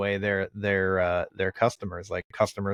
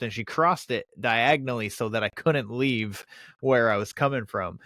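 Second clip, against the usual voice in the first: usual speaking voice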